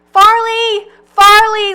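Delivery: high, loud desperate call; frantic shouted name. A narrator's reading. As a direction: call to him as he's dragged off. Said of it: "Louise calls".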